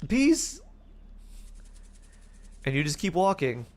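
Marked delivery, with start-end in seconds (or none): 0.1-0.6 defiant exit. Retold or imitated. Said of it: "You're like".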